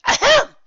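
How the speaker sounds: loud high throat-clearing; forced ahem. Acted. Teacher demanding the class's attention, forced ahem. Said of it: "with a somewhat forced authority".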